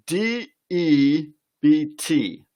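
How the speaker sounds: slow, letter by letter, D E B T; spelling out letters. Shown as a demonstration. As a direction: spell D E B T out slowly, each letter apart. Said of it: "It's spelled".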